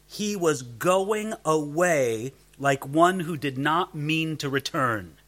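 slow, weighted stress on "going away"; emphatic. A narrator's reading. Preacher quotes a commentator on the Greek verb, slow and emphatic. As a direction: lean on "going away", drawing the words out. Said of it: "There is an emphasis on the idea".

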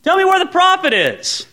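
high, loud oblivious cheer; dumb and happy. Acted. Saul strolling up to the prophet, dumb and happy. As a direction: chirp it up high like a friendly dope. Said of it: "kinda dumb and happy".

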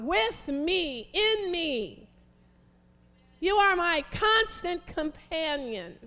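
loud, high, slow pleading prayer; full-force preaching prayer; impassioned prayer. Real, not acted. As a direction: plead for God's presence. Preacher prays the psalm aloud.

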